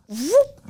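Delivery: a high bird whoop. Retold or imitated. Telling how the turkey dropped in, high whoop.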